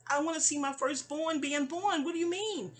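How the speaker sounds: imitated high-pitched protest; mock indignant protest. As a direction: play his disbelief, pitched high. Acting out father's protest to nurse.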